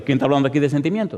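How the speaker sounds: ordinary talk, nothing marked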